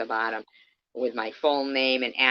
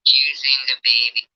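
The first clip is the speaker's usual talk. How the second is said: much louder, hammering accusation; real indignant raised voice; angry loud rant; 8 dB louder than usual. Real, not acted. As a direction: throw the accusation at them. Ranting at E-Beggars.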